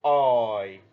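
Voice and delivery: "oi" drawn out, gliding from rounded to close; teacher modelling the oi diphthong; long oi sound. Shown as a demonstration. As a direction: stretch "oi" into its glide. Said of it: "long sound".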